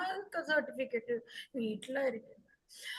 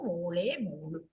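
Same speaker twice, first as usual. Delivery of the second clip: lower, quicker echo of മോളെ, മോളു; low repeat; 8 semitones lower, slower than usual. Acted. Parents repeating the daughter's pet name, lower and settled. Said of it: "ഞങ്ങളുടെ മോളിനെ ... വിളിക്കാറുള്ളൂ".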